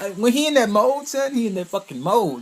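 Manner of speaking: loud, high, fired-up rave; excited fan yelling about his favorite character; hyped shout. Real, not acted.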